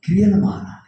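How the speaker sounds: loud, high, driving sermon cadence; fiery preaching. Acted. Preacher rousing the congregation about being used, fiery preaching. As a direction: preach it out to the back rows.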